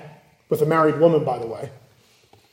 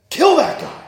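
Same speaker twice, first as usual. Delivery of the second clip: much higher, a curt command; cold royal order; 11 semitones higher than usual. Retold or imitated. Preacher acting out Solomon ordering Jeroboam killed, curt command. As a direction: bark it like a spoiled king. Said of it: "No, he says".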